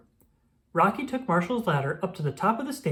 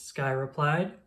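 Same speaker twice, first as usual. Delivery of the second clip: ordinary delivery, nothing marked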